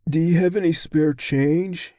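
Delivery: high, loud beggar's voice; high-pitched street panhandler, loudly asking; middle-aged bum. A narrator's reading.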